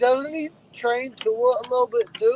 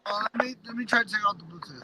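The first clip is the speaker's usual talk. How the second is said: phone held away; 8 dB quieter than usual. Real, not acted. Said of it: "it sounds like you're far away from the, the phone".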